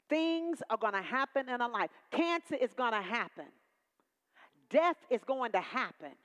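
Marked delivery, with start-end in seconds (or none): none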